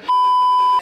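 loud, high laugh; burst of laughter. Real, not acted. Laughs at own punchline.

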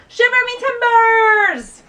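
loud, high, slow pirate cry; pirate exclamation. Shown as a demonstration. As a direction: bellow it like a startled pirate. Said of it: "that you yell when you wanna get someone's attention".

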